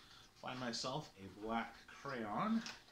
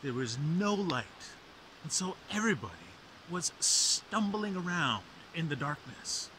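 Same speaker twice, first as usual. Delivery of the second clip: dramatic legend narration; myth teller; 6 semitones higher, 11 dB louder than usual. A narrator's reading. Myth retold, higher and louder.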